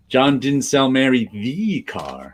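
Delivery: slow read with emphatic, stretched "the"; stress on THE. Shown as a demonstration. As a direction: lean on "the" before "car". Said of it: "you put the emphasis on each".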